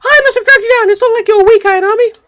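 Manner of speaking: put-on very high, loud apologetic girl; a shrill cartoon apology; high squeaky anime girl. Comforting Ayanami, very high squeaky girl voice.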